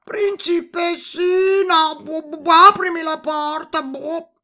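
high, loud, drawn-out croaky call; toad shouting. A narrator's reading. Toad calling the princess to open up, high croaky shout. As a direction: shout through the door as a toad.

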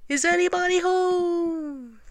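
high, slow, drawn-out call. A narrator's reading. Pooh calls into the rabbit hole, high drawn-out call. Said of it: "called out".

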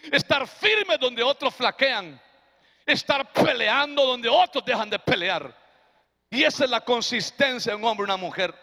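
ordinary talk

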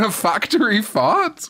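laughing in disbelief. Real, not acted. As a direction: giggle the question.

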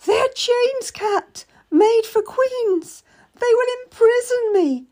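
high, slow frantic wail; hysterical wail. A narrator's reading. Hysterical lady wails about the crown, high and slow. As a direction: fall apart in terror. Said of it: "started to wail".

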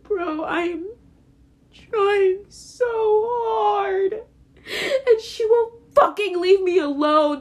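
crying. Real, not acted. Venting about his mother, crying and pleading. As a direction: voice breaking into real tears, slow and strained.

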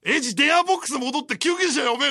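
put-on drunk yell at the screen. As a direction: holler at the TV like a drunk fan. Drunk friend yelling at TV. (酔っ払いの叫び)

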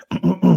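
loud throat clearing after choking. Real, not acted. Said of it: "I'm choking here".

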